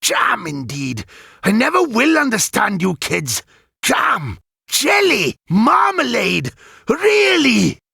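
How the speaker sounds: high, shouted indignant tirade; exasperated rant. A narrator's reading. Galland ranting at kids' slang, high indignant tirade. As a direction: play to an invisible jury.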